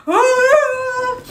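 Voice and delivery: yelling at the TV. Retold or imitated. Imitating the household men yelling at the TV: loud mock yell. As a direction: cry out like a fan at the screen. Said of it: "they're like".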